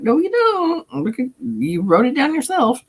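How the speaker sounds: high teasing correction; a touch of know-it-all; lightly mocking friend. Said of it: "he's like".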